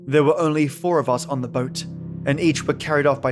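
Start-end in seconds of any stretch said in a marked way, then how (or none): none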